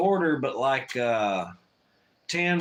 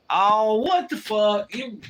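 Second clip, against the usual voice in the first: disbelief; 6 semitones higher, 3 dB louder, slower than usual. Real, not acted. Reacting to chat comment.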